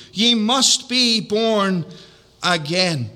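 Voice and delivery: "Ye must be born again" very slow, each word weighted; slow emphatic preaching. Acted. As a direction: drop the pitch and level on "again". Preacher stresses the key text.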